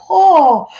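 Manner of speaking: high laughing oh at the food; delighted surprise. Retold or imitated. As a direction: gasp with a laugh. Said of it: "he's like".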